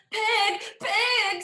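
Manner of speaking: loud urgent call. A narrator's reading. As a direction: call the pig from afar. Hen calling out to pig.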